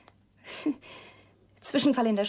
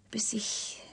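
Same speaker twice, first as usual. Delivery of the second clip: trailing off into a softer whisper; 8 dB quieter than usual. Acted. Breaking off her story to the reporter, whispered. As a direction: let the words die away.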